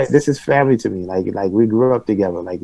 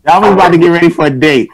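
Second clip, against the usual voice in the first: loud, high, quick tease; raised, rapid teasing announcement; calling out teasingly; 8 semitones higher, 7 dB louder, faster than usual. Real, not acted. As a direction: call it out high and fast to a friend. Calling out teasingly to muted friend.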